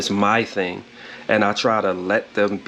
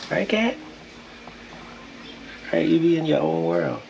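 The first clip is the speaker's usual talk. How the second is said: high sing-song voice for the cat; high pet-talk voice; 7 semitones higher, 4 dB quieter than usual. Real, not acted. Talking to the cat, high pet-talk voice.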